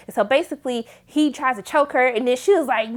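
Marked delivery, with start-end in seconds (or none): none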